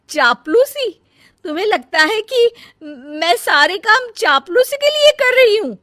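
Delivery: high, loud, indignant shouting; loud indignant yelling at her sister-in-law; outraged shout. Acted. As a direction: hurl the insult back in disbelief. Elder one outraged in quarrel.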